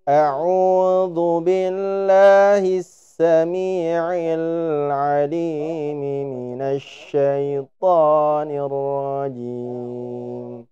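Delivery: slow melodic Quran recitation of أعوذ بالله السميع; recited ta'awudz. Shown as a demonstration. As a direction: chant أعوذ بالله slowly, drawing out the vowels. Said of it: "misalnya yang agak panjang dikitan". (bacaan ta'awudz dengan tartil, lambat dan berlagu)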